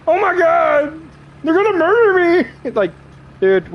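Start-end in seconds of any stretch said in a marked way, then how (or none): 0.1-2.5 high, loud mock terror; mock panic. Streamer squealing in fake fear about fans.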